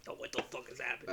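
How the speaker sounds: soft, fast, stunned mutter; hushed shocked aside. Retold or imitated. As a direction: whisper it in disbelief. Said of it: "I was like".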